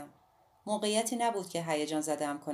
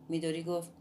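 plain reading voice

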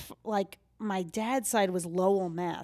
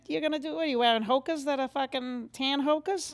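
pitched-up Boston accent; Boston accent, voice placed up high; higher-placed Boston accent; 7 semitones higher than usual. Shown as a demonstration. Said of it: "Boston's a little bit up here".

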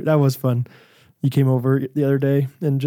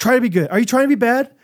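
higher sing-song "Try to be good"; parent voice; 9 semitones higher, 3 dB louder, faster than usual. Retold or imitated. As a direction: say it as dad to a toddler. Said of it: "That's what I'm saying now".

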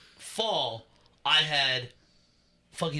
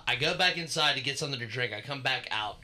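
ordinary talk, nothing marked

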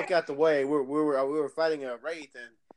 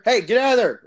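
loud, raised mock shout; retold yell; 4 semitones higher, 12 dB louder than usual. Player yelling at someone to leave the car, loud. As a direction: yell like a pushy teammate.

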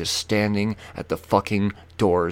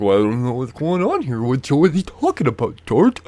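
higher, put-on puzzled onlooker voice; confused bystander voice; 4 semitones higher, 4 dB louder than usual. Bystander asking George what's happening, puzzled put-on voice. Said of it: "They're like".

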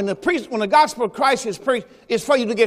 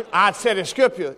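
normal talking voice

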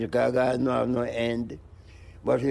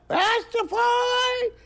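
slow falsetto crowing of Rastafari; rooster crow; slower than usual. Pretend. Crowing like a rooster for the interviewer, slow falsetto. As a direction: crow it out high and stretched like a rooster. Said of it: "says my cock".

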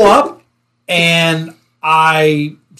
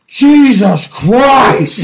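slow, loud, drawn-out exasperation; loud drawn-out exasperated shout; 6 semitones higher, 4 dB louder, much slower than usual. Retold or imitated. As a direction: sergeant yelling at the rookie. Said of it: "And he goes".

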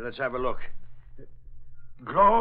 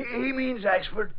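ordinary delivery

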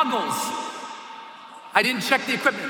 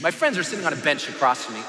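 normal talking voice

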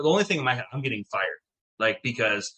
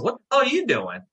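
startled retort; 11 semitones higher than usual. Retold or imitated. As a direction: fire the same question right back at the cop in shock. He throws cop's question back.

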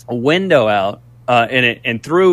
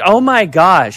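mock fake surprise; 4 semitones higher, slower than usual. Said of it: "like, bad acting".

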